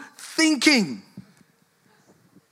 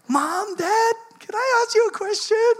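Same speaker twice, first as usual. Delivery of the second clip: put-on high, sweet little-kid voice; pitched-up child asking permission; kid's voice; 14 semitones higher than usual. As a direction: wheedle like a child at bedtime. Kid interrupting late at night.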